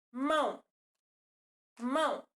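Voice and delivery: MÃO said as one beat; one single syllable. Shown as a demonstration. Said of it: "Eu pronuncio, é, uma única vez". ("mão" numa única sílaba)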